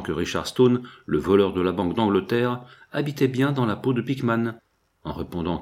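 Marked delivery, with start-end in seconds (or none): none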